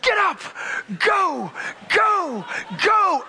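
mock-panicked cries, each one punched; frantic urging. Nervous passenger urging the plane at takeoff, frantic urging=.

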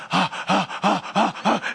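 rapid gasping breaths; hyperventilating. Retold or imitated. Passenger after screaming, imitated hyperventilating. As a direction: imitate panicked panting in short bursts.

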